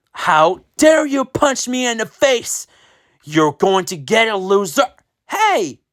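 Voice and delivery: high, furious threat; bully threatening Diego after the punch, high and furious; outraged bully. Acted.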